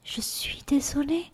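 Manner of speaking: whispered meek apology; imitating a meek whisper. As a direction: breathe the apology in a tiny voice. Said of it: "ce simple".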